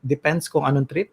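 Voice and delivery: normal talking voice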